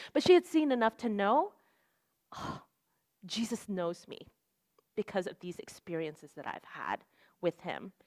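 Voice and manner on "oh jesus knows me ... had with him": very soft, low, awed quote; soft sigh into hushed wonder; hushed realization. Retold or imitated. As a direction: quietly voice someone realizing it for the first time. Voicing the convert's quiet realization.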